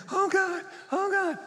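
high, loud panicked prayer; desperate mock panic; panicked cry. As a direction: cry out in terror as the plane drops. Panicking passenger in falling plane.

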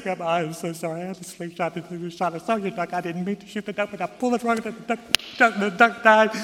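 high, loud blubbering confession; mock weeping. Boy confessing to Grandma, mock weeping. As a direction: wail it out in a hammed-up sob. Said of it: "He starts to weep".

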